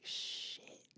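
breathless unvoiced whisper of dismay; shocked whispered swear at the price; whispered curse. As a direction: whisper it like the bad news just hit. Stunned by the phone price.

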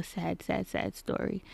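hushed teaser for a sad story. Real, not acted. Introducing a sad upcoming episode.

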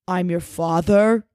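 slow, hammed-up dad voice; odd put-on father voice. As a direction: put on a strange, ponderous father voice. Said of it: "I don't know what voice that was".

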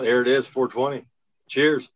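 calling out cheers loudly; loud toast. Real, not acted. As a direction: raise your voice for the toast. Toast called out to the room.

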